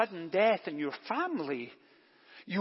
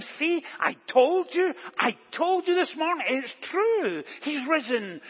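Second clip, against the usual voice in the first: high, louder and bursting with vindication; higher pitched, triumphant I-told-you; excited; 6 semitones higher, 3 dB louder than usual. Retold or imitated. Mary telling the disciples she was right, excitedly.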